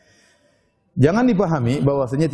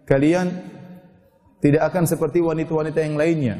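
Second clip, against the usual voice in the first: usual speaking voice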